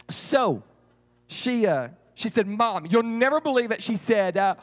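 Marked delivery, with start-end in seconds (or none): 2.5-3.8 high-pitched, eager announcement; bursting to tell mom the news; excited girl. Acted. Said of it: "I was super duper excited".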